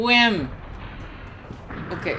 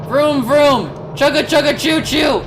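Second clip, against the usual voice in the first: playful engine noises; 7 semitones higher, 5 dB louder than usual. Pretend. Imitating vehicle noises to drive.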